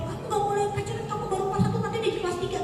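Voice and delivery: high, clipped forbidding parent; strict parent. Acted. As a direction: lay down the rule to your kid.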